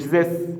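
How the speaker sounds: "this" said with a z, as "zes"; deliberately wrong "this", z for th; mispronounced th. Shown as a demonstration. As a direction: start "this" with a buzzing z, tongue behind the teeth. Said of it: "مش this".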